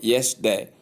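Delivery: "yesterday" with the middle syllable dropped, YES-day; shortened mispronunciation. Shown as a demonstration. Teacher quoting how some people say it, shortened mispronunciation. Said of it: "Some people call it".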